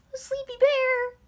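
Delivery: very high, gushing coo; excited squeal. Real, not acted. Teller gushing over the motel's name, squealing. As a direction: squeal over something unbearably cute.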